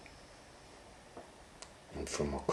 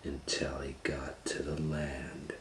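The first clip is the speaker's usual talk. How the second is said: story whisper; 11 dB quieter than usual. A narrator's reading. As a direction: whisper it, hushed and mysterious. Hushed story reading.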